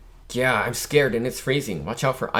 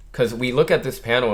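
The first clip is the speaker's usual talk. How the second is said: normal talking voice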